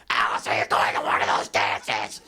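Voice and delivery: imitated mom in a distorted, loud put-on voice; mocked mom. Mom teasing her son about going to the dances, mocking. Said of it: "my mom was like".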